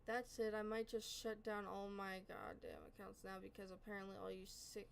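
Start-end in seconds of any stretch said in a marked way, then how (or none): none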